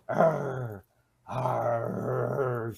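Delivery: long, drawn-out animal-like growls; imitated growl. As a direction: imitate a snarling star like a wild animal. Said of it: "snarling, growling".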